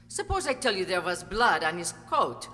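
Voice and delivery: corner the lawyer with each threat; rising threat. Acted. Wife threatens damning testimony.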